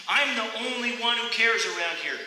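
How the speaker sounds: high, loud complaint; frustrated cry. Retold or imitated. Poem's runner crying out in frustration. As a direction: cry out that nobody listens. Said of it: "I cried".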